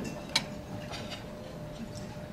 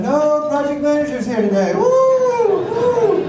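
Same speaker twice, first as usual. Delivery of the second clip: giddy high whooping; high, loud mocking cheer, rising to a squeal; gleeful engineers; 10 semitones higher, 11 dB louder than usual. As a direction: whoop like kids with the boss gone. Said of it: "They're running around going".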